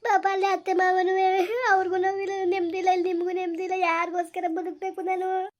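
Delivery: sobbing self-blame, pitched high; high, tearful despair; crying. Acted. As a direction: weep between the phrases. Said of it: "ಅಳ್ಬೇಡ ಕಣವ, ಅಳ್ಬೇಡ ನೀನು".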